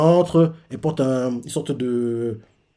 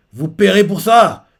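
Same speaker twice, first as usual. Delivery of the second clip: a louder, slowed threat, each word weighed; slow menacing threat; 5 semitones higher, 6 dB louder than usual. Retold or imitated. Chopin threatening the Boogies, slow loud threat. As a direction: promise revenge, slow and loud.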